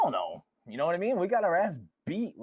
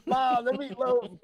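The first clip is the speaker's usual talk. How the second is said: high, slow laughing whine; slow laughing kid demand; mock spoiled kid; 8 semitones higher, 5 dB louder, much slower than usual. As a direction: ham up a brat. Mocking bratty kid.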